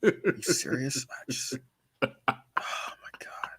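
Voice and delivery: hushed, breathy disbelief, acted out; imitating annoyed Joker. Host voicing Joker fed up with his foe, mock annoyance. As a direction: sigh it out like an exasperated villain. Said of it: "He was legit annoyed".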